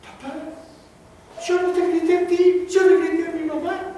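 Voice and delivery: high-pitched panicked pleading; acted scared child protesting to father; frightened boy. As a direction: start small, then cry out the excuse. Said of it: "yo asustado le digo".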